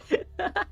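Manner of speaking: a sudden high-pitched laugh; burst of laughter. Real, not acted. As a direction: break out laughing at the chat. Laughs at chat donation.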